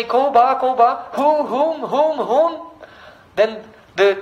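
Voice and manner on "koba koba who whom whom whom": high hooting bird calls; birdcall imitation. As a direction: raise the pitch and hoot like a bird. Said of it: "when the birds say".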